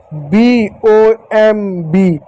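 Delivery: spelling out. Shown as a demonstration. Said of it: "एक और शब्द है".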